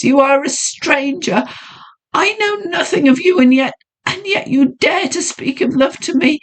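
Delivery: high, reproachful heroine; anguished lady voice; distressed young woman. Acted. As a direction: push him away in anguish. Heroine reproaches her suitor.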